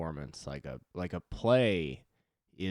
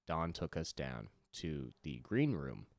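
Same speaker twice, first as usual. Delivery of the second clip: normal talking voice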